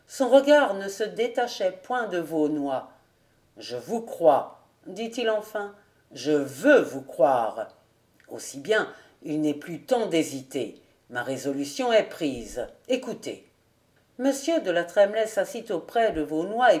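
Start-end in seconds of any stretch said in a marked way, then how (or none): 3.6-4.6 low, slow, deliberate; weighty old man's voice, pitched down; grave old nobleman. A narrator's reading. Old nobleman accepts his cousin's pledge, grave and low. 6.1-13.5 put-on weighty old man's voice, pitched down; grave old nobleman. A narrator's reading. Old nobleman accepts his cousin's pledge, grave and low.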